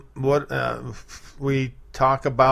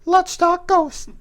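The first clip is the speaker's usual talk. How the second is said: Will; 4 dB louder than usual. Acted. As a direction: announce it in a put-on falsetto. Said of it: "I can pretend to be Will".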